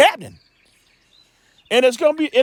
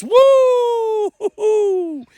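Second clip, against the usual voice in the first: high whooping cry; sudden high shout of woo; 11 semitones higher than usual. Real, not acted. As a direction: let out a high woo of amazement. Preacher cries out at scripture.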